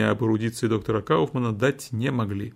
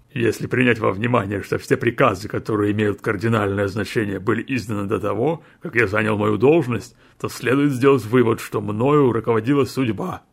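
defendant's last word. A narrator's reading. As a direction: insist defiantly on fate.

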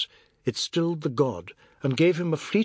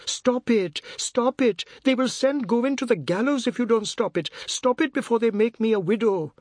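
high-pitched, desperate begging; frantic high appeal; wailing plea; 9 semitones higher, 3 dB louder than usual. A narrator's reading. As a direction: wail for the fight to stop. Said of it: "sharp wail".

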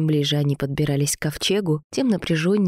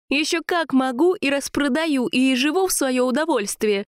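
high, heated comeback; defiant retort; 9 semitones higher than usual. A narrator's reading. Sasha insists she will sell the find, high defiant retort. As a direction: defy him in a high voice.

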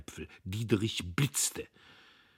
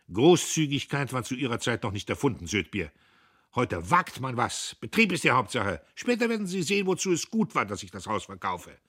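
boastful heir; 5 semitones higher, 6 dB louder than usual. A narrator's reading. Diederich boasting.

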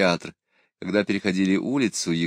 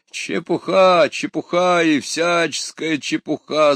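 loud, high, drawn-out shout; 9 semitones higher, 6 dB louder, slower than usual. A narrator's reading. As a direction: bellow it out, slow and high. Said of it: "закричал Пыркин".

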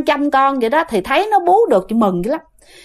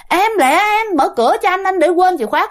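higher, hurried calling as the husband; urgent call at the door; 6 semitones higher than usual. Retold or imitated. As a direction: press her to open the door, calling up high. (giọng chồng gọi gấp, cao giọng)

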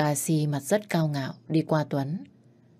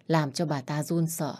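usual speaking voice

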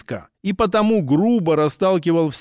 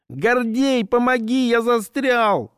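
panicked plea; 6 semitones higher, slower than usual. Acted. Stuck in window, pleading.

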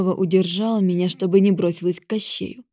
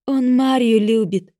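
ordinary delivery, nothing marked

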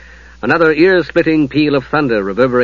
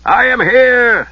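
slow, loud call; crying out; 10 semitones higher, 4 dB louder, much slower than usual. Acted. Lord answering his squire over the noise. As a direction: call out above the turmoil. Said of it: "Harry Aiken cried out".

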